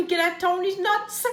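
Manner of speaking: usual speaking voice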